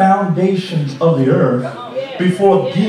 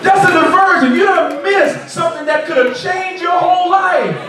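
preaching shout; 9 semitones higher than usual. Real, not acted. Preacher's climax.

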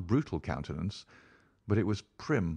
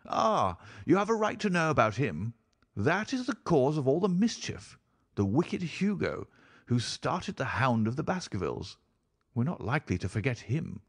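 higher-pitched baronet with swooping emphasis; baronet host, animated; 5 semitones higher than usual. Acted. Baronet pointing out the wicked ancestor, animated and high. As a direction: tell the family scandal in a lifted voice.

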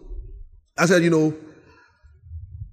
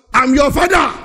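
higher, loud bossy father voice; mock stern father; 8 semitones higher, 6 dB louder than usual. Pastor mocking a bossy father to his son. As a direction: lay down the law like a pompous dad.

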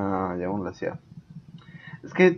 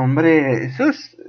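ordinary talk

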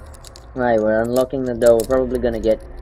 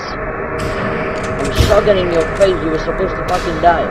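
frantic shouting; 5 semitones higher, 3 dB louder than usual. Real, not acted. Fighting boss in panic.

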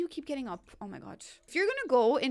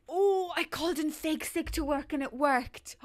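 gleeful Sim voice; 5 semitones higher, 4 dB louder than usual. Retold or imitated. Voicing Sim who faked sick.

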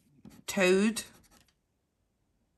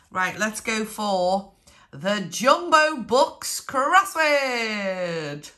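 ordinary talk, nothing marked

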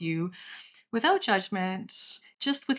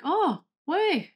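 put-on French accent; 11 semitones higher than usual. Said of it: "give you a response in French".

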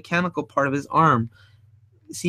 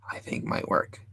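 whispered aside; 13 semitones lower, 11 dB quieter than usual. Real, not acted. Muttering to himself.

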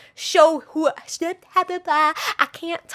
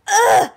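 high, loud groan of irritation; sudden frustrated yelp; pained grunt; 10 semitones higher, 10 dB louder than usual. Real, not acted. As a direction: let out a sharp, high "ugh". Something in eye.

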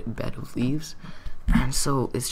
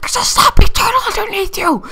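very high, loud screamed warning; panicked alarm at top pitch; frantic warning; 15 dB louder than usual. Retold or imitated. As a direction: shriek the danger at him. Warning friend in water.

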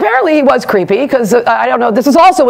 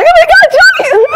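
very high, loud fan squeal; breathless squeal at a celebrity; starstruck squeal; 5 dB louder than usual. Retold or imitated. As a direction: shriek his name like a starstruck fan. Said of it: "I'm like".

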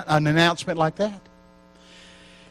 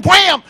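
a sharp, loud wham with a higher pitch; mock slam sound; 12 semitones higher, 8 dB louder than usual. Preacher acting out another worker hitting the floor, loud wham. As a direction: bang it out like a sudden fall. Second woman struck down.

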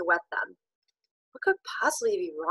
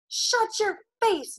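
very high, slow screamed order; 14 semitones higher, slower than usual. Acted. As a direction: scream him into silence. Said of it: "Ben screamed".

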